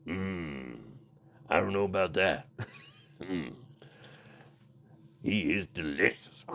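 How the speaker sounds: low, soft, hammed-up seductive murmur; mock sultry. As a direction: purr it as a joke come-on. Continues sultry joke voice.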